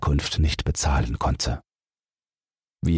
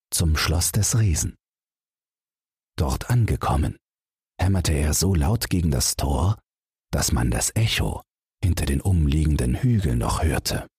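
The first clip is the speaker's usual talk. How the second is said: ordinary delivery, nothing marked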